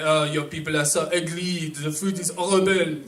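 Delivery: lightly voiced snooty French speaker; a hint of a French accent, dismissive; mock French person. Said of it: "a French person can say".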